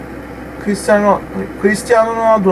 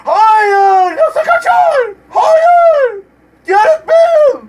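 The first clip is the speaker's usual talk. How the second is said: loud very high cries of no; wailing at a wasted chance; anguished yelling; 9 semitones higher, 4 dB louder, much slower than usual. Real, not acted. Gamer howling at Bale's missed goal. Gamer despairs at Bale miss.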